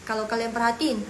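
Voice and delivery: ordinary talk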